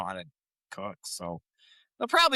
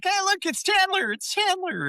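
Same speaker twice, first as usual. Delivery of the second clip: much higher, gushing announcement; mock excited. Monica presenting Chandler to her parents, high gush. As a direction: show him off with nervous glee.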